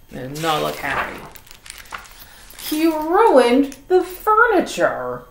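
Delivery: usual speaking voice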